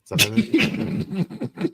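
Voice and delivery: high, exaggerated French Canadian voice; hammed-up Quebec French accent. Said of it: "should I try my French Canadian accent?".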